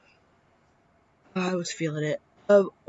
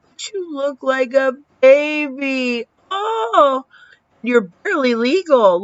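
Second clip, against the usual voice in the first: playful teasing; 6 semitones higher, 5 dB louder than usual. Retold or imitated. Teasing friend about his age.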